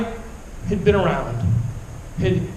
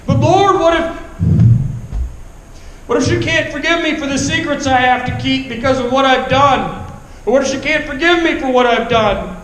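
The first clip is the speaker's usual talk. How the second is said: desperate plea; 4 semitones higher than usual. Retold or imitated. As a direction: beg desperately for forgiveness. Pleading with God.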